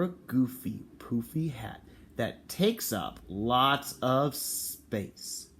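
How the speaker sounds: slow, expressive storybook reading; playful read-aloud voice; reading to children. Acted. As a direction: read it out slowly to little kids. Reading the picture book aloud.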